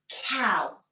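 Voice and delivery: usual speaking voice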